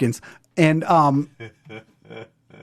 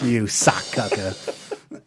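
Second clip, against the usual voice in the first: mock insult; 7 semitones lower, 5 dB quieter, much slower than usual. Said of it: "I was like".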